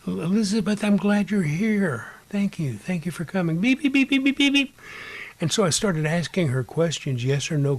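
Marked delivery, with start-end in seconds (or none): 0.1-3.6 high-pitched, grateful welcome; raised pitch, gushing thanks; delighted greeting. Retold or imitated. Greeting the ghost, retold. 3.6-4.8 rapid high, loud beeps; string of electronic-style beeps on one high pitch; imitated meter beeping. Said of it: "she would beep yes".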